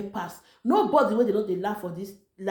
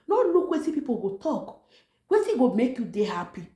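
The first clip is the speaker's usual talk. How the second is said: usual speaking voice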